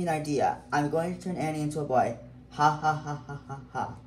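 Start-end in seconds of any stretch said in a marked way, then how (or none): 2.5-3.9 evil laugh. Acted. Villain plots to transform Annie.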